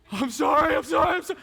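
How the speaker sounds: loud, frantic pleading apology; panicked apology. Retold or imitated. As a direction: blurt it out as a scared kid begging forgiveness. Little brother after kicking jaw.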